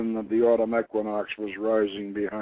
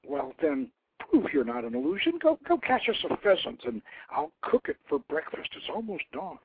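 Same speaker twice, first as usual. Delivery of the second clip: high playful challenge, fading softer at the end; teasing young woman; 9 semitones higher, 3 dB quieter than usual. A narrator's reading. Pugat dares the eagle to catch a pheasant, high and teasing. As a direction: dare him lightly, then trail off.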